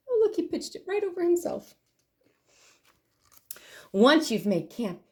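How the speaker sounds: usual speaking voice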